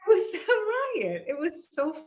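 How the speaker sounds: high, loud laughing exclamation; real delight spilling into laughter; laughing burst. Real, not acted. Laughing recall of puppet scene.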